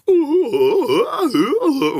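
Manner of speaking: very high, loud fanboy squealing; excited squeals. Retold or imitated. Friend Josh fumbling the camera, high giddy squeals.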